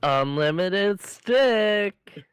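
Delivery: very slow, loud waitress proclamation. Acted. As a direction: drag it out like a grand reveal. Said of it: "you do the waitress".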